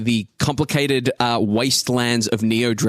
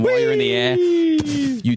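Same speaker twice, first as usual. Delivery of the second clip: excited. A narrator's reading. Launching off the bend mid-air.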